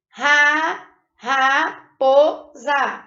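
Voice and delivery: raposa drawn out very slowly as ra-po-sa; Ra, raposa spelled out slowly in syllables. Shown as a demonstration. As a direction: sound out raposa one syllable at a time. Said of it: "eu emiti três sons".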